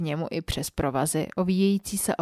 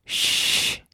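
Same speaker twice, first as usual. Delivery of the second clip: drawn-out, breathy "shh"; shushing hiss. A narrator's reading. Man hushing the woman, unvoiced shush. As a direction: hush them with a long shh. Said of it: "zašeptal mi hrubě do ucha".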